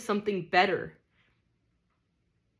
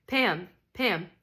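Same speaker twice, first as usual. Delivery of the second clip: ordinary delivery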